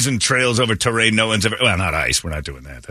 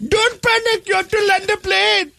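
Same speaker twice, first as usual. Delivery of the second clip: put-on much higher, squeaky Indian accent for the trapped man; mock Indian accent. Trapped passenger to crew, much higher mock Indian accent. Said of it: "in that voice".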